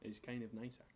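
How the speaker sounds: soft, low posh Scottish accent; refined, gentle Scottish. Shown as a demonstration. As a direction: demonstrate a quiet, genteel Scottish accent. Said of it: "which is like this".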